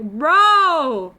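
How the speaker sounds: loud, high laughing Bro; excited shout. Retold or imitated. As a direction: call it out mid-laugh. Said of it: "I was like".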